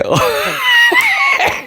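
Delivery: high squealing burst of laughter; a sudden high shrieking laugh. Real, not acted. Laughing at stupid comment.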